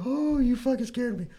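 high, shaken woman's voice, slowing down; startled older lady. Retold or imitated. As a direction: imitate a frightened older woman catching her breath.

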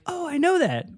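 high, mock-amazed realization; acted sudden recognition. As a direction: act out a fan's dawning recognition, pitched high. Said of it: "but you're like".

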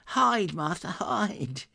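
put-on high, hurried children's urging; a hint of kids hushing; urgent children. A narrator's reading. Children urging Martha to hide.

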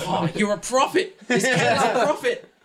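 high, loud exultation; overjoyed amazement. Acted. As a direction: hail a miracle in elation. Yuri overjoyed at the recovered book.

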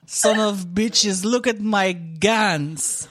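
slow, proud Russian dad boast; Russian accent. Retold or imitated. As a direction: flex like a Russian gym dad.

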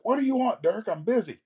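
very high-pitched mock yell; yelling back. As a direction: imitate a busy publisher yelling at his friend. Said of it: "they yell at each other".

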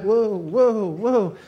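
comic flustered cries; mock alarm. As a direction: act out trying to control scattering piglets. Acting out chasing clamoring piglets.

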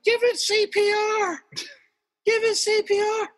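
mock squeaky girls shouting advice; girls urging. Girls on the balcony urging him, high and girly. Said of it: "And girls are like".

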